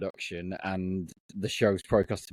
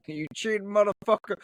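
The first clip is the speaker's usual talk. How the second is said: mock Southern accent; 12 semitones higher than usual. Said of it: "I can't do a Southern accent".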